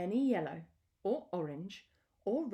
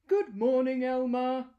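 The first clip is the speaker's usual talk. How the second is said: louder, higher animal voices calling out; loud cheery greeting; 5 semitones higher, 10 dB louder than usual. Acted. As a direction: call out a bright hello to Elmer. Jungle animals greet Elmer.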